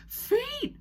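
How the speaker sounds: high, loud yelp of alarm; yelled alarm. Acted. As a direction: shriek it like a tiny dog fleeing. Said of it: "Diva would yell".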